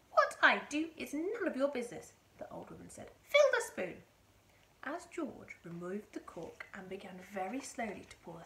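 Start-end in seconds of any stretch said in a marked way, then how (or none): 0.1-2.1 higher, loud indignant retort; snappish old woman. A narrator's reading. 3.2-4.0 high, loud command; bossy old woman. A narrator's reading. Old woman giving George orders.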